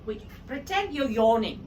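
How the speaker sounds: ordinary talk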